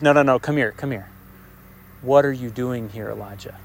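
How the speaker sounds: hushed, intimate divine question. Acted. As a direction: draw him close and ask gently. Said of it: "I think it's an intimate way".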